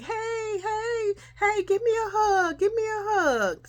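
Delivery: put-on high, eager little-girl voice; a little sister begging for a hug. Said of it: "Delisha was like".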